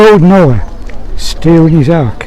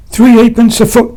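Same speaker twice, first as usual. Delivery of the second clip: stubborn Sam; 7 semitones higher than usual. Acted. Stubborn answer, chest-deep in floodwater.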